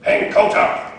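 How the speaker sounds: Jackie Chan voice. Retold or imitated. Preacher imitating the master ordering the kid, broken English. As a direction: bark the order like a stern kung fu master. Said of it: "He's like".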